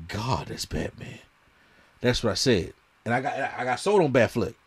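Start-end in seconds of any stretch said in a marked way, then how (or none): 0.1-1.2 soft, slow and awed; a quiet reverent sigh of approval; awed hush. Retold or imitated. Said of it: "I was like".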